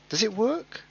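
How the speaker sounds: high falsetto question; silly high voice. Pretend. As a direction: test a daft high voice. Testing a voice for fun.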